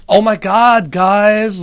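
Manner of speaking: loud, high mock gasp at the guys; mock-outraged loud exclaim; mock shocked shout. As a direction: yell it out like a scandalized bro.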